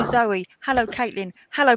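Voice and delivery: ordinary delivery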